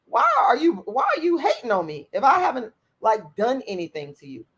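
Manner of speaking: usual speaking voice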